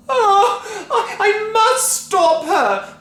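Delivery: high stammering wail. A narrator's reading. Wife wailing as her mother vanishes. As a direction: wail helplessly. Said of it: "Missus Cranky wailed".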